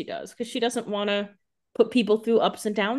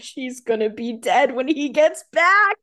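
slow, spooky doom voice, a touch higher and louder; ominous prediction; 4 semitones higher, 4 dB louder, slower than usual. Retold or imitated. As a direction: drag it out like a horror-movie narrator. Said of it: "I was like".

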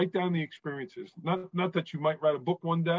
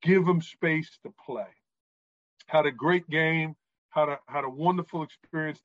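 usual speaking voice

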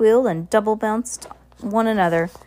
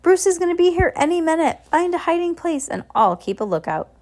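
high, excited announcement; announcing to a crowd; 10 semitones higher than usual. Acted. Heidi warning party guests that Bruce is coming, announcing. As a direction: call it out to a room of guests. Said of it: "she announced to the crowd".

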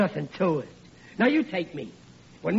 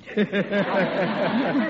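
hearty laugh; 4 semitones higher, 3 dB louder than usual. Acted. [name] laughs at his joke.

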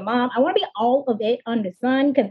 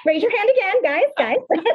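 much higher, laughing call to viewers; excited call-out; 8 semitones higher than usual. Real, not acted. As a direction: call out to the audience through laughter.

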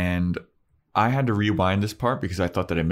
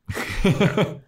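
bright high laugh bursting out; high burst of laughter; 13 semitones higher than usual. Real, not acted. Laughing at the co-host's recap. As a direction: crack up at the joke. Laughing at Buffy's flat reply.

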